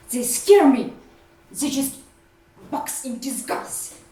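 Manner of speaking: loud, high-pitched fearful outburst; agitated fear. Acted. Confessing a fear of butterflies, agitated and loud. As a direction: blurt the fear out loudly.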